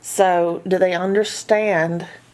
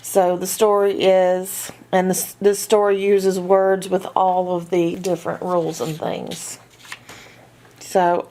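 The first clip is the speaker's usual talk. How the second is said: usual speaking voice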